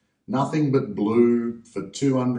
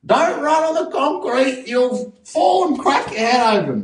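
put-on much higher, slow, loud fretting old nana; nana yelling; 4 dB louder, slower than usual. Grandmother yelling at a child up a tree: high, slow mock scolding. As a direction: shriek a warning like a worried grandmother. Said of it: "they yell out".

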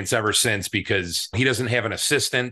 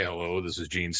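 [name]; 5 semitones lower, 8 dB quieter than usual. Retold or imitated. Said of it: "this is the voice I've been listening to".

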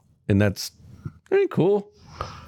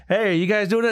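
calling out; 10 semitones higher than usual. Retold or imitated. Rock calls out from car.

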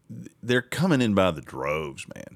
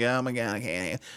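a mock whine, trailing off softer; mock whining; 4 dB quieter than usual. Mocking cities complaining about buses, in a whiny mock voice. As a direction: whine like a helpless city official. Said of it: "they just whining like".

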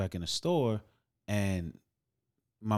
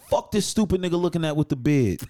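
hostile stranger; 7 semitones higher, 7 dB louder than usual. Pretend. Imagined stranger on train.

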